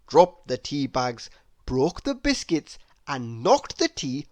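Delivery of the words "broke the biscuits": usual speaking voice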